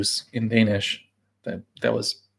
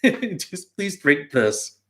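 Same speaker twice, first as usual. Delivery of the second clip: laughing plea; 6 semitones higher, 3 dB quieter, much slower than usual. Pretend. Pleading sign on left-out beer.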